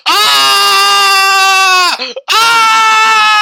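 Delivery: put-on very high, loud scream like a little girl. Brother blasted into space, mock scream. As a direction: wail while flying into space. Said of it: "he was yelling and going like".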